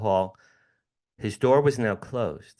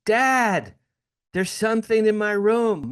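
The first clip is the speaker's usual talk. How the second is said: retold frightened son calling out, much higher and louder; scared child calling; 11 semitones higher, 5 dB louder than usual. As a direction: cry out to dad in the night, scared.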